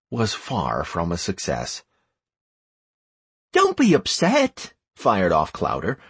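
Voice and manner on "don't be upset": put-on high boyish voice; boy talking eagerly; young boy voice. A narrator's reading.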